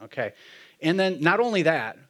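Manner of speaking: ordinary delivery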